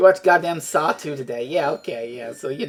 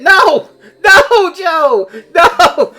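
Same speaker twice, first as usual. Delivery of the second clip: higher, louder laughing no; laughing protest; 9 semitones higher, 10 dB louder, much slower than usual. Real, not acted. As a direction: protest through laughter at a friend. Protesting friend's comment, laughing.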